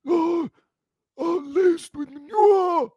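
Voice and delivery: very high, slow, hammed-up refined voice; mock posh BBC commentator. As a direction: play a prissy posh announcer, drawn out. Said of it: "so you say".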